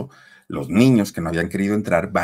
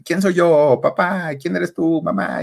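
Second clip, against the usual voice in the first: put-on high, sing-song voice for a baby; parent teaching a baby; 9 semitones higher, slower than usual. Parents quizzing their baby, in high sing-song. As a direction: coax a toddler to say the names.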